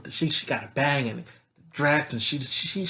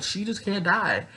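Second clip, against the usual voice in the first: usual speaking voice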